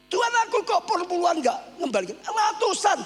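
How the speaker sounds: indignant retold outburst at God; angry protest. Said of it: "Saya lebih marah". (protes marah sambil berteriak)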